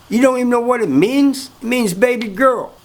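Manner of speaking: high-pitched mock amazement; incredulous reveal.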